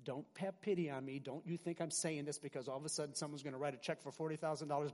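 usual speaking voice

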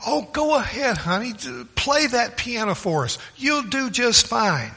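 lightly acted, urge her on in a high voice; coaxing church lady. Lady behind urges the reluctant wife to play, coaxing.

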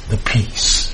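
whispered. Acted. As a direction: drop to a breathy whisper on the peace. Preacher repeats the key phrase.

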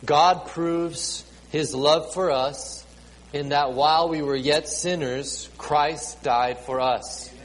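ordinary delivery

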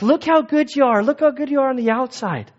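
high-pitched fake praise; hammed-up admiring voice; mock admiration. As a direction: coo over someone's fine appearance. Mimics admiring the Pharisees' appearance.

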